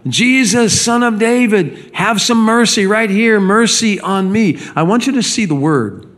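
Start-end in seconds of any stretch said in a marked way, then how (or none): 0.1-4.6 high, pleading cry for mercy. Acted. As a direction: call out desperately to Jesus, pitched high. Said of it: "he began to cry out and say or yell".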